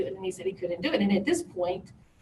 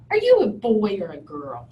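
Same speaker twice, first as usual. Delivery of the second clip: blunt demand; 6 dB louder than usual. Retold or imitated. Lady sizing up a slender stranger, blunt and loud. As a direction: imitate a blunt, loud questioner. Retold rude question from lady.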